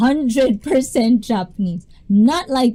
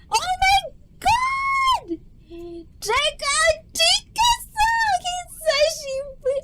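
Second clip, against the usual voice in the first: excited squeal; slower than usual. Real, not acted. Greeting friend joining the stream.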